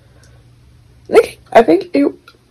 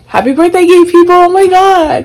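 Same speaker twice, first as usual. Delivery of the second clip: excited cheer; 11 semitones higher than usual. Real, not acted. Podcaster cheering on Pride month listeners, excited. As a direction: cheer it out to the crowd.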